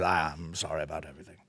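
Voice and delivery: hushed, low mock apology; Farage. Politician apologising to the public, mock-contrite and hushed.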